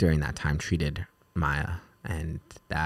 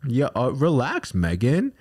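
mock dismissive; 8 semitones higher, 4 dB louder than usual. Mimicking how he brushes her off, high and teasing.

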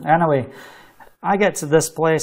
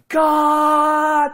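long held, high-pitched call; shouting out to God; 10 semitones higher than usual. Retold or imitated. Man with his head in an empty tank, shouting to God. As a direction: call it out high, stretching the vowel. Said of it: "I need to shout out to God".

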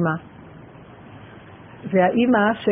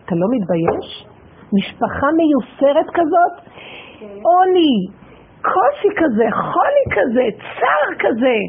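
raging plea at heaven over suffering; angry rebuke; 4 semitones higher than usual. Retold or imitated. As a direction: hurl it upward in protest. Woman rebukes God angrily.

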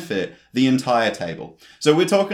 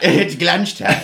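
playful mock old-timer; 3 semitones higher, 4 dB louder, slower than usual. Playful echo of the bit.

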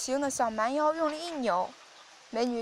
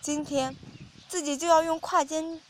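usual speaking voice

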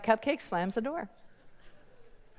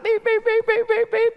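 high, loud repeated beeps; evenly spaced mechanical beeping; imitated reversing beeps; 9 semitones higher, 6 dB louder than usual. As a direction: mimic a truck's backup alarm. Imitates a vehicle backing up.

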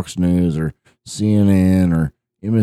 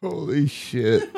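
slow, high, laughing exclamation; gasped out mid-laugh; 5 semitones higher, 4 dB quieter, slower than usual. Real, not acted. Friends cracking up on podcast.

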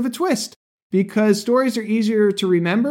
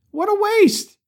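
exclaiming; 13 semitones higher than usual. Real, not acted. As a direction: throw up your voice in mock dismay. Host's exclamation about dying ants.

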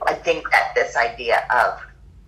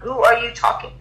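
mock outrage at the yoga teacher; offended surprise; 11 semitones higher than usual. Answering the teacher who insulted her, high mock indignation. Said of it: "And I was like".

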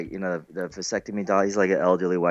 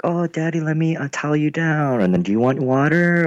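put-on sweet child; 8 semitones higher than usual. Voicing his sweet kids.

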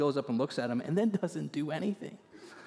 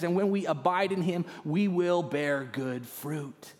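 full-force preaching; 5 semitones higher than usual. Acted. Preacher urges the church to abide in Christ, full-force and rising. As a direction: lift the room with each phrase.

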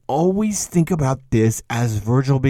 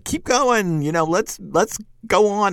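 a high, eager cheer of encouragement; cheerleader Virgil; 9 semitones higher than usual. Retold or imitated. Lecturer voicing Virgil as a pep-talk cheerleader, high and eager. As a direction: urge him up the slope like a coach. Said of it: "this Virgil is much more of a cheerleader".

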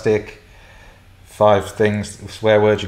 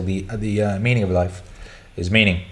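ordinary delivery

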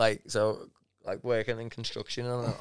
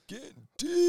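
high mock echo. Echoing joke line.

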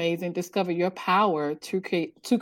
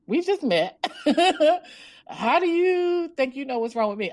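higher, incredulous mock indignation, laughing; indignant inner thought; 7 semitones higher than usual. Thinking back at a near-stranger, mock indignant. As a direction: push back in playful disbelief with a laugh. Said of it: "I'm thinking to myself".

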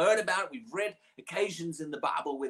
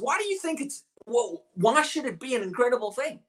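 higher, lively retelling of the question; preacher retelling Paul's question to his listener, higher and livelier; paraphrased question; 7 semitones higher than usual. Retold or imitated. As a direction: toss the question out casually, pitched up. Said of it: "He says".

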